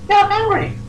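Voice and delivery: very high, chirpy little filly voice; squeaky-high, perky reply; chipper. Acted. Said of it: "chipper up, and goes".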